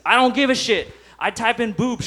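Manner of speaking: ordinary delivery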